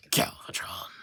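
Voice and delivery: low unvoiced villain whisper; hushed dramatic villain reveal. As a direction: announce the villain in a dark breathy hush. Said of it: "why did I say that with a voice".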